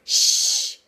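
long unvoiced "shh"; loud shush. A narrator's reading. Fox hushing the giggling rabbits, loud shush. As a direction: shush them loudly. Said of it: "said the fox loudly".

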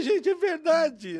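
high, slow, exclaimed realization; retold revelation. Musician realizing his bandmate is right, high animated wonder. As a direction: stretch it out in amused wonder.

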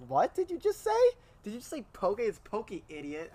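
high, incredulous scoffing voice; mocking voice. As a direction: scoff like a smug know-it-all. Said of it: "people are like".

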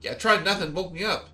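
high, complaining squeaky voice; grumpy woken creature. Acted. Meepo grumbles at the party for waking him, high and whiny. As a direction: whine about being woken up.

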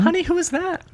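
very high mom voice. Acted. Mother asking her son about the door, high voice. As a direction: call out like a mother.

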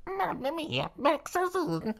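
very high squeaky Italian voice; high-pitched Italian voice. Acted. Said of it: "Wait, sorry".

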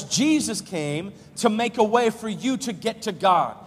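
fervent sermon delivery; preaching at full force. Acted. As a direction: preach it hard to the congregation.

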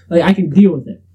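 normal talking voice

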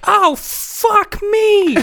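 very high, drawn-out squeal; horrified wail. Acted. Onlooker spattered with gore, horrified squeal. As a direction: stretch it slowly into a mock-horrified shriek. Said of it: "three members' faces who look horrified".

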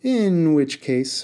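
slow, sugary threat; sweetly. Acted. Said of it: "Haroon said sweetly".

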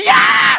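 very high, loud karate shout; hammed-up karate battle cry; karate kiai. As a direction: scream a sharp kiai. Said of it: "pas mau latihan".

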